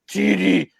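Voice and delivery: lightly voiced French dad calling his son; a hint of a stern father calling; Thierry's dad. Teasing a friend about a French tape, a lightly voiced father calling. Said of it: "Thierry's dad".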